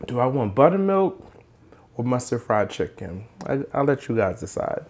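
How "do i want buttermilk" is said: ordinary talk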